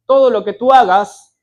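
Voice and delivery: "Todo" hit loud to open a warning; loud preaching. Acted. Preacher warning the family heads, loud. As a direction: shout the warning to the listeners.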